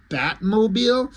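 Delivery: mock incredulous girlfriend; incredulous question. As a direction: say Batmobile? as if it's absurd. Girl baffled by the name.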